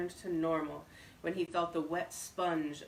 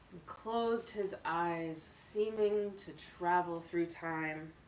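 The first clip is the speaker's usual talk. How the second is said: ordinary delivery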